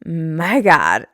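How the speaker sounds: retold gasp of a woman losing her wig on a podcast; imitating shocked woman. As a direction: squeal it like the woman whose wig just fell off. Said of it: "She's like".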